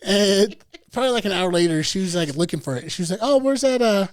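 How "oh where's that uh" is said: higher, mock-innocent asking; mimicking the girlfriend's innocent question; mocking her voice. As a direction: play her fake-clueless ask. Girlfriend voiced in retold story.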